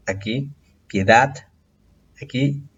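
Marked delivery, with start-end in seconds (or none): 0.9-1.5 "piedad" stressed on final DAD; stress on last syllable. Shown as a demonstration. Teacher shows where the accent falls, stressed last syllable. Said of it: "aquí hay acento en la última sílaba".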